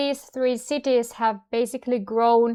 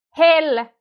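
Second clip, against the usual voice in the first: "hel" punched louder; strong, isolated "hel"; stressed first syllable; 5 semitones higher, 6 dB louder than usual. Shown as a demonstration. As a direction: hit "hel" hard. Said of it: "it's the strongest".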